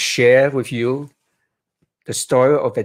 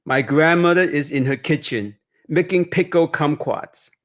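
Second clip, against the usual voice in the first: put-on reading aloud to children, lively and higher; picture book narration; 4 semitones higher than usual. A narrator's reading. Author reading his Chinatown memoir to children, expressive storytelling. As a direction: paint each image for young listeners.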